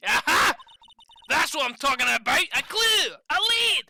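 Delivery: loud, high triumphant outburst; excited detective. Acted. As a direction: jump on it, building to high, loud cries. Acted detective thrilled at a clue.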